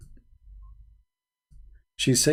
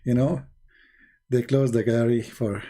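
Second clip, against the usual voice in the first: ordinary delivery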